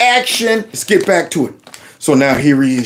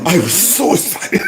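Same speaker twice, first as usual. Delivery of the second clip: mimicking a tearful man; overacted choked-up imitation; mock waterworks. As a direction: put on fake weepy emotion. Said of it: "Cue Waterworks".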